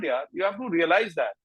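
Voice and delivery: ordinary talk, nothing marked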